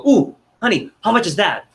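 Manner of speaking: playful mock shopper asking the price; eager curious shopper. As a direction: gush the question like a customer who wants it. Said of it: "I go".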